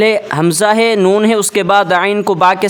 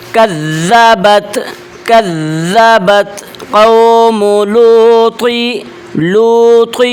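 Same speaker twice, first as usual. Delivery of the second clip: Quran recitation; slower than usual. Shown as a demonstration. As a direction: stretch each vowel like a reciter. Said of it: "اس لفظ کو ہم سیکھتے ہیں". (آہستہ، بلند آواز میں تلاوت)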